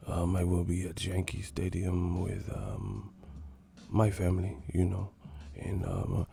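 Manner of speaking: low, soft, hesitant murmur; imitating a whispery boxer with an accent; Miguel Cotto impression. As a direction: trail along quietly between ums. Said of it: "that's how Cotto be doing".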